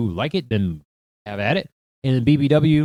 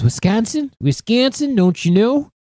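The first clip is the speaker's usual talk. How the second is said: put-on high-pitched Fargo accent, flattened A in Wisconsin; 9 semitones higher than usual. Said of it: "I missed out on my, um, Fargo accent".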